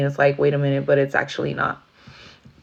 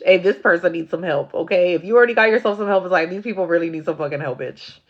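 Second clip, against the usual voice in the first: usual speaking voice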